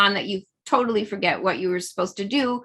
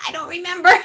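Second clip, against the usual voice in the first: higher, laughing forgetful cry; laughing I don't remember; 7 semitones higher, faster than usual. Shown as a demonstration. Laughter yoga teacher demoing forgetting, higher laughing. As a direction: toss it off with a laugh. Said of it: "So we're gonna try".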